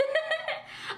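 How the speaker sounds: bright burst of laughter; high laugh. Real, not acted. Laughs at own joke.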